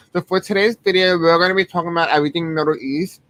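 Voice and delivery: ordinary talk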